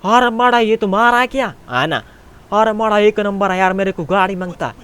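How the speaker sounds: high, loud Pathan character voice; Pathan accent. Pretend. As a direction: play a keen customer with a Pathan accent. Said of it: "अबे पठान". (पठानी लहजे में ऊँची आवाज़)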